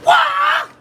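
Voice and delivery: very high, loud kiai shriek; high martial arts scream; Bruce Lee yell. Retold or imitated. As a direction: let out a Bruce Lee battle cry. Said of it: "You ever heard Bruce Lee?".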